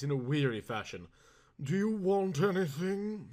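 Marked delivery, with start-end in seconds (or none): 1.6-3.3 a hint of a slow lordly drawl; lightly voiced noble gentleman. A narrator's reading. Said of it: "in a most noble voice".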